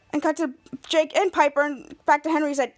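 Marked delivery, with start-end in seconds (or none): none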